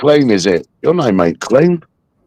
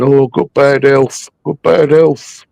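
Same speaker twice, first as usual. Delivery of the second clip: slow, higher whiny mock plea; mock pleading whine; 6 semitones higher than usual. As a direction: whine it like a faker begging sympathy. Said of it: "plead fucking".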